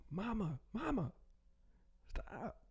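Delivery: high-pitched mock plea to mama; embarrassed kid protesting. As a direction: protest to a meddling mother in a high voice. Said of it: "and I'm like".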